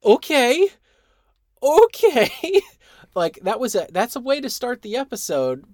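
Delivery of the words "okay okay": high, slow shocked okay; stunned reaction. Retold or imitated. Viewer reacting to Khan's cruel joke, high stunned okay. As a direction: gasp out okay in disbelief. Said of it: "I went".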